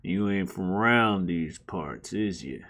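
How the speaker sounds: low, soft Southern cowboy greeting; low, hushed Southern cowboy voice; cowboy Southern accent. Retold or imitated. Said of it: "it's colloquialisms".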